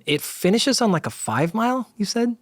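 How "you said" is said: ordinary delivery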